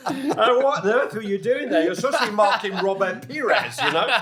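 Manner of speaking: high, loud laughing mock reprimand; mock scolding. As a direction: tease him loud through laughter. Mock telling-off of Wayne.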